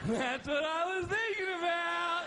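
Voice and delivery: high, slow, loud goofy voice; loopy drunk. Retold or imitated. Husband on NyQuil answers his wife, high goofy drunk voice. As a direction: sing it out like a happy drunk. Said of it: "I'm like".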